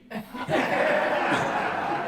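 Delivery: high breathy burst of laughter; real high cackle. Real, not acted. Laugh after retort.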